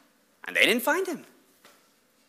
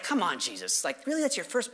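low, soft incredulous aside; quietly exasperated aside; muttered disbelief; 5 semitones lower, 9 dB quieter than usual. Acted. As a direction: question him under your breath. Muttered aside doubting Jesus.

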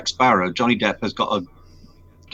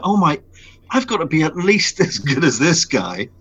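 nervous excitement; 6 semitones higher than usual. Retold or imitated. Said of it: "'cause I'm like".

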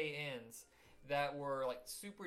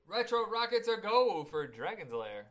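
loud, raised launch announcement; announcer call; 5 semitones higher, 8 dB louder than usual. Pretend. Host announces the show segment start.

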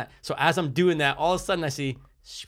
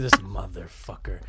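inner grumble; 7 semitones lower than usual. Retold or imitated. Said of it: "In my head, I'm".

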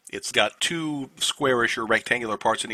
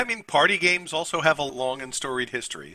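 ordinary delivery

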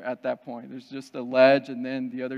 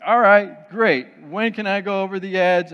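loud, higher, eager burst; imitating excited wife; 5 semitones higher, 8 dB louder than usual. Excited wife asks to go over the edge, eager. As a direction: play the wife raring to go. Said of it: "Amber's excited".

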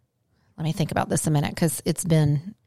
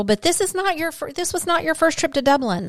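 higher-pitched, insistent reproach; exasperated scolding; 5 semitones higher than usual. Real, not acted. Co-host nagging about Trinity College library, exasperated. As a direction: lecture a friend who never listens.

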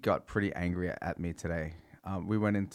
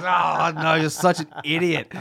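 exasperated; 7 semitones higher, 9 dB louder, slower than usual. Retold or imitated. Girlfriend's mom exasperated at him.

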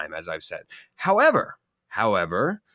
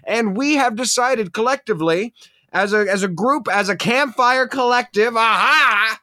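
mock grand announcement; 7 semitones higher, 4 dB louder than usual.

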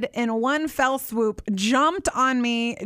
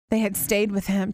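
ordinary delivery

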